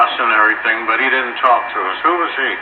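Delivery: usual speaking voice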